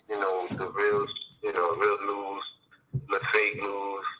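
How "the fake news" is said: usual speaking voice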